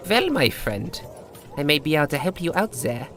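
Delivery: hint at a secret deal; sly old bartender. Acted.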